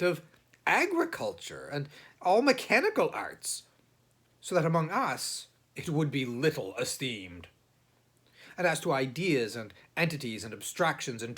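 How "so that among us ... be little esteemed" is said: plain reading voice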